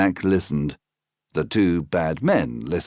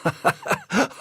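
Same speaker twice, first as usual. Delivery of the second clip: laugh. Acted.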